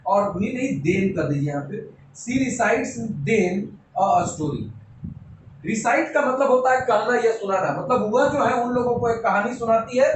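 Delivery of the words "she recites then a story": slow dictation. Shown as a demonstration. Teacher writing the example.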